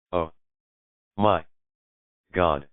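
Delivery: "Oh. My. God." with a long pause after each word; word by word, stunned. A narrator's reading. Roommate suddenly spotting the ring, "Oh. My. God." as three separate sentences. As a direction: drop "Oh", "My", "God" like separate stones. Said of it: "Each word falls out of her mouth like a stone".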